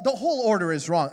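ordinary talk, nothing marked

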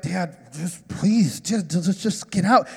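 put-on low, halting plea of a mortified kid; acted-out kid begging Dad to stop; embarrassed pleading. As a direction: plead under your breath, haltingly. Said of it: "my kids are getting embarrassed".

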